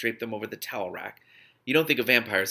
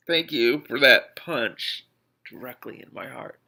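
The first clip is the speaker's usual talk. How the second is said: mock wounded; 2 semitones higher, 6 dB louder, slower than usual. Real, not acted. Reader reacts, hurt, to the text.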